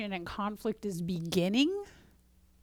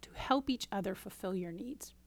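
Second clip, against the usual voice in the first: soft whispered question; hushed whisper; 6 dB quieter than usual. Acted. Coach whispers the point to listeners, softly. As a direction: let it fall to a whisper.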